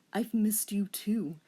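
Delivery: put-on whispering boyfriend; whispered reply. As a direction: whisper it back tenderly. Voicing male love interest.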